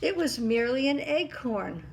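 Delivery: loud triumphant reveal; king voice. A narrator's reading. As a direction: announce it like a proud king.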